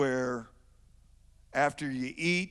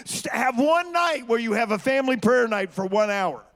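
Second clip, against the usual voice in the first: preaching at full force; 5 semitones higher, 7 dB louder than usual. Acted.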